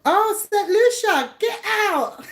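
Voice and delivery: real delighted disbelief; gasping with delighted surprise. Real, not acted. Viewer reveals Saint Lucia.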